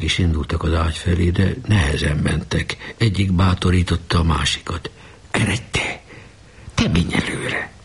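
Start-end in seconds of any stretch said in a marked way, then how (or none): 5.3-7.7 hushed, nervous whisper; urging whisper. A narrator's reading. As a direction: push your friend forward under your breath. Boys urging each other at deathbed.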